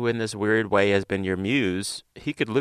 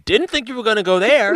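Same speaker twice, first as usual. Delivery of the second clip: mock shock; 12 semitones higher, 6 dB louder, faster than usual.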